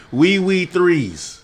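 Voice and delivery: slow, high mock French; hammed-up Franglais plea; fake foreign language. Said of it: "I just mixed in Spanish, fake, uh, French".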